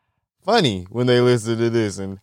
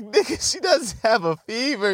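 high, incredulous squeal; incredulous look; 10 semitones higher than usual. Retold or imitated. Son squeals at dad in disbelief, high and far. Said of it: "I'm looking at him like".

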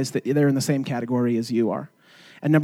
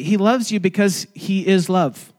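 normal talking voice

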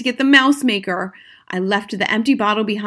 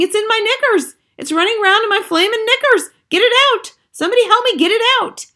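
very high, loud comic panic; screaming; 12 semitones higher, 5 dB louder than usual. A narrator's reading. Cook with mouse in his knickers, screaming. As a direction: shriek for someone to rescue you. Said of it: "the man was screaming".

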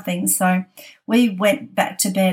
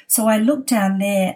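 ordinary talk, nothing marked

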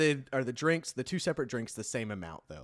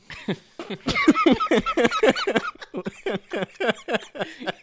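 loud high peals of laughter; loud laughing fit; 6 dB louder than usual. Real, not acted. Delighted with their own show intro, loud high laughing fit. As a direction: burst out laughing and keep going.

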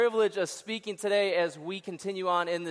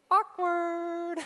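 comic awkward; 9 semitones higher than usual. Acted. Comic beat.

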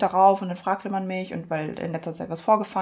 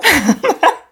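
loud burst of laughter; 9 semitones higher, 9 dB louder than usual. Real, not acted. Laughs at the insult.

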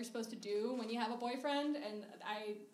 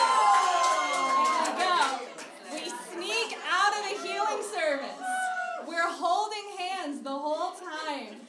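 high, loud, drawn-out storytelling wail; overwrought shouting; 7 semitones higher, 11 dB louder, slower than usual. Acted. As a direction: belt it out with mock drama. Acts out her overwrought escape.

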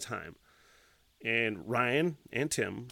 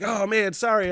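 higher-pitched mock apology; apologetic neighbor; 7 semitones higher, 4 dB louder than usual. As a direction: lightly imitate the guy saying sorry.

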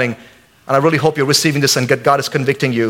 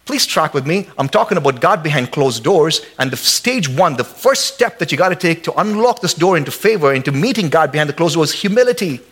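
ordinary delivery

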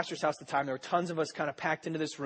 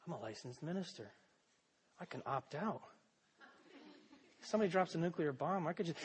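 inner thought; 13 dB quieter than usual. Retold or imitated. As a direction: confess it half under your breath. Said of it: "And then it hit me".

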